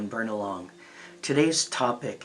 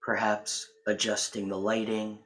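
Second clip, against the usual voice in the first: guided relaxation; 6 dB quieter than usual. Real, not acted. Inviting listeners to relax and turn inward, guided relaxation. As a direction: guide them gently into calm, phrase by phrase.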